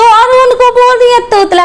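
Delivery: a mocking babyish whine. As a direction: keep up the lisping baby mockery. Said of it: "खुद तोतली है".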